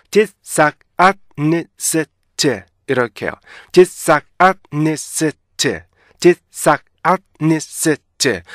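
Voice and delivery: "dishonest" chopped tit-sak-at-nit-set-te, very slow; stop-sound syllables. Shown as a demonstration. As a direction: punch each syllable of "dishonest" separately, cut short. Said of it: "스탑 사운드별로 나누셔야 돼요". ("dishonest"를 음절마다 끊어서 천천히)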